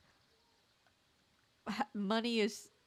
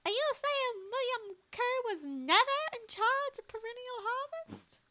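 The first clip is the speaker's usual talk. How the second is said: lightly voiced high-pitched shocked character. Player voicing a character asking the villain, high incredulous voice.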